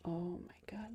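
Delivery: whispered disbelief; thrilled whisper at the pledge count; excited whisper. Real, not acted. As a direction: gasp it in a whisper. Fundraiser count rising.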